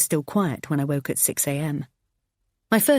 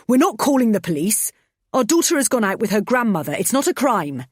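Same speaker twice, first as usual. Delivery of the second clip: angry shouting; 6 semitones higher, 5 dB louder than usual. Acted. Husband arguing back loudly.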